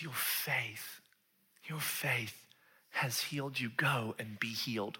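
soft, unhurried reassurance; hushed, sincere blessing; gentle affirmation. Acted. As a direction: say it quietly and tenderly. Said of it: "So he's not like".

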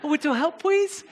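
put-on high-pitched baby-talk plea; little kid. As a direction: toddler asking for help, "little" as "wittle". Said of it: "a big-eyed little kid reaching up to you".

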